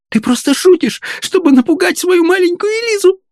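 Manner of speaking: put-on very high, little-girl weeping voice; tearful pleading. A narrator's reading. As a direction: plead tearfully that it must be a joke. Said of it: "рыдала несчастная девица".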